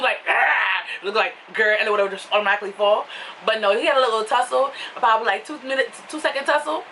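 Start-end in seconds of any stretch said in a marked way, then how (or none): 0.3-1.8 a quick playful grr like a tiger; imitated tiger growl. A tiger clawing through cloth, a lightly mock growl.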